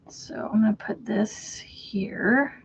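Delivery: soft whisper to herself. Real, not acted. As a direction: whisper it slowly.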